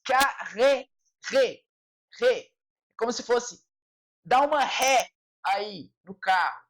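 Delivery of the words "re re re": re said with a strong throaty R; forced French R. Shown as a demonstration. Teacher drilling the R of carrément: forced throaty re. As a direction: push re hard from the throat. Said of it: "Olha só o R".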